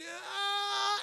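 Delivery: high, drawn-out cartoon scream; mock scream. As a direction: scream as if about to be hit. Imitates Harold's scream before impact.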